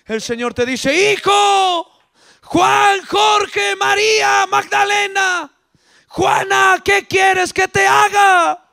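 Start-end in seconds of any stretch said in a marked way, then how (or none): 1.1-8.6 high, shouted roll of names; calling out. Acted. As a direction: summon each one as the Lord. The Lord calling believers by name.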